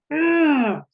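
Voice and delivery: high, loud angry "Ugh" through a tight jaw; angry growl. Shown as a demonstration. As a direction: show anger with a clenched-fist grunt. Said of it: "that's where anger tends to be".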